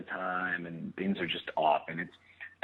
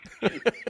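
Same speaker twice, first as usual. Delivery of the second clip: laugh; 4 dB louder than usual. Real, not acted. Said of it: "so bad".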